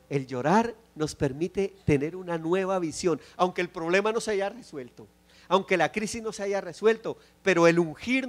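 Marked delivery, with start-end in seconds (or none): none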